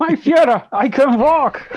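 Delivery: high, loud, ecstatic German cry; hammed-up Strangelove outburst; Doctor Strangelove. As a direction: leap up yelling like Strangelove. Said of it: "in a Doctor Strangelove style".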